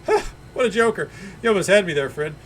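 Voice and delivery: forced cheer. Acted. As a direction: brush off the insult brightly.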